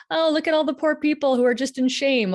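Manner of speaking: high, mock-pitying voice. Mocking pity for women stuck in shame. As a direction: sound falsely sorry for them. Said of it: "I can't be like".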